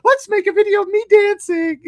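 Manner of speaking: high, loud, slow syrupy mock-mother voice; mother cooing at her kid for a video, high and hammed-up. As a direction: ham up a fake-sweet mother. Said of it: "And the mother's like".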